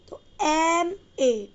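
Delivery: "एम ए" spelled out slowly, each letter drawn out; slow classroom spelling of "एम ए". Shown as a demonstration. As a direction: draw out "एम ए" letter by letter so the first sound is clear. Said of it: "ए की sound आ रही है आपको".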